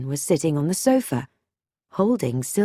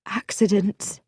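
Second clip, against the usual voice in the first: hesitant woman; 3 semitones higher than usual. Acted. Moira hints at attempts on her life, hesitant. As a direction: offer it tentatively, as an uneasy admission.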